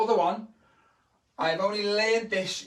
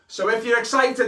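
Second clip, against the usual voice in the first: hyped-up call; 4 semitones higher, 7 dB louder than usual. Acted. Hyping up kids for a cheer.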